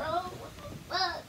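high slow shocked what the fuck; stretched out in disbelief. Real, not acted. Shocked at own look.

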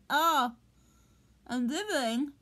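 usual speaking voice

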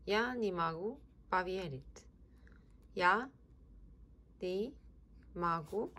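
ordinary talk, nothing marked

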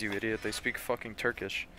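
normal talking voice

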